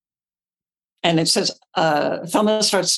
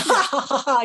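higher, hollow pretend laughter; fake laugh; 7 semitones higher than usual. Shown as a demonstration. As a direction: deliberately fake stagey laugh. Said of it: "faking it".

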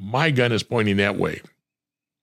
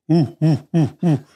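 higher, louder excited ooh; mock crowd hooting; 7 semitones higher, 4 dB louder than usual. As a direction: imitate gang members cheering. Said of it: "started going".

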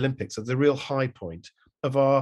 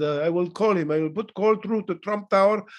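heavy put-on Russian accent; lightly hammed-up Russian accent; mock Russian accent; 6 semitones higher, 3 dB louder than usual. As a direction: play it as a Russian official on the phone. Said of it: "I don't know why he was speaking Russian".

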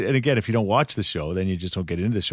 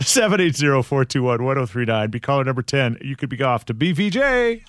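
loud, high radio announcer call; radio contest promo; 6 semitones higher, 5 dB louder than usual. Acted. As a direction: pump up the contest like a DJ. Calling out contest number.

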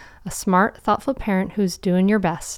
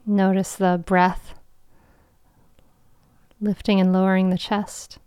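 guided meditation. Acted. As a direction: guide them gently into calm.

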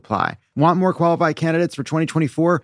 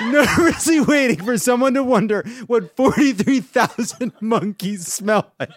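laughing; 7 semitones higher than usual. Real, not acted. Reads through laughter.

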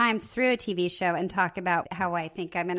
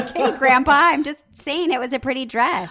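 a loud, raised, sing-song excuse; mock defensive; 5 semitones higher, 8 dB louder than usual. Mimicking the friend who keeps commenting. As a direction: play the chatty friend brushing it off. Voicing a chatty TV partner.

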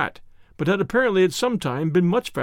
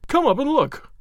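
high, heated order; agitated demand, pitch well up; yelled command at moderate volume; angry yell; 8 semitones higher than usual. A narrator's reading. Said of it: "he yelled".

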